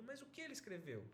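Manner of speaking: lightly voiced wife, quietly asking; questioning wife. Wife asking her husband about the telegram, quietly puzzled. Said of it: "E aí ela pergunta".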